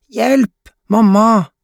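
put-on very high child voice; squealing little girl. A narrator's reading. Mia calls mother for help, high squeal. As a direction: cry out in playful mock alarm.

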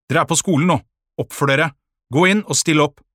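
strict, forceful scolding; stern teacher. A narrator's reading. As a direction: order the pupils about firmly. Strict teacher orders pupils into class.

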